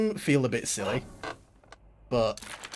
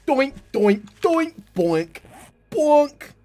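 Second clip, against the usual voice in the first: loud, high cartoon bonk noises; doink sound effect; 12 semitones higher, 7 dB louder, much slower than usual. Gamer bonking zombie heads, loud high cartoon doinks. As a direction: punctuate each hit with a silly bonk.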